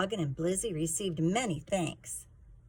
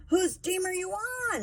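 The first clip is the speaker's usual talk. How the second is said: much higher, louder mock scream; screamed in a fit; 13 semitones higher, 4 dB louder than usual. A narrator's reading. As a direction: shriek it like a bully in a tantrum. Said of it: "Sleater screamed in a fit".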